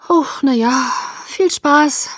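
loud, high, put-on cheer; feigned enthusiasm. A narrator's reading. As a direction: fake being thrilled, not very convincingly. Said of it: "Begeisterung vorzutäuschen". (vorgetäuschte Begeisterung, hoch und laut)

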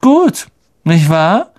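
slow, casual indifference; offhand. A narrator's reading. Malfoy showing off the brooms, slow and offhand. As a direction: toss it off as if it means nothing. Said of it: "mit gleichmütiger Stimme".

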